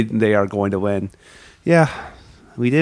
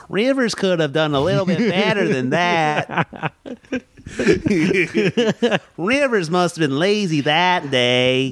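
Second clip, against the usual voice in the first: higher, drawn-out put-on voice; exaggerated, higher mimicry; Ben Miller impression; 5 semitones higher than usual. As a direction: stretch the words in a hammed-up impression. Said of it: "devolved into Ben Miller impressions".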